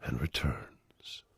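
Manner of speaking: slow fading whisper. Acted. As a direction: whisper and returns slowly. Poem line whispered.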